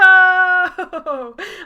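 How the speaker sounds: loud, high wailing laugh; half laugh, half wail; anguished laugh-cry. Real, not acted. Player wailing with laughter as the prince is taken.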